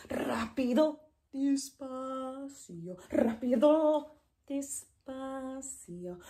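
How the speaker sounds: brisk rápido, stretched despacio; fast versus slow. Shown as a demonstration. Teacher contrasting rápido and despacio by pace. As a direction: alternate quick and drawn-out words. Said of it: "fast and slow".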